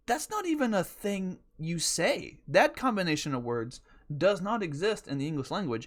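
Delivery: normal talking voice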